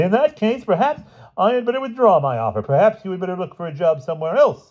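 very high, huffy dignity; cold offence. A narrator's reading. Owner withdrawing his job offer, coldly offended. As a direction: withdraw the favour with icy pomp. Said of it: "said the owner of the paper coldly".

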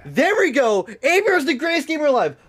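high, hammed-up victory cheer; triumphant boast. Real, not acted. Streamer celebrating his own play, boasting triumphantly. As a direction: brag it like a champion. Bragging after a good play.

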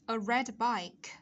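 slow, measured model of "A red bike, a blue bike"; careful teacher's demonstration, unhurried; model reading. Shown as a demonstration. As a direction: read out the phrases slowly for learners. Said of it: "đọc các cái cụm từ này".

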